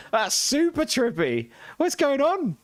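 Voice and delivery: high, slow squeal at the trippy graphics; squealing at buggy visuals; amazed squeal. Real, not acted. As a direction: let your voice shoot up in disbelief. Strange graphics on screen.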